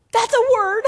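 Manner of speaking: high, very loud whoop of approval; hammed-up delighted outburst; excited exclamation. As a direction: shout it out high, breaking into laughter. Acts out own excited reaction.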